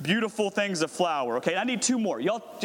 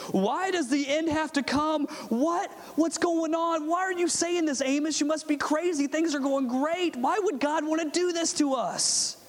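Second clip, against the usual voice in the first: higher, baffled protest against the prophet; indignant Israelites; 8 semitones higher than usual. Acted. Congregation voicing Israelites protesting to Amos, higher and indignant. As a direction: play the comfortable crowd, shocked and offended. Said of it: "the Israelites thought to themselves".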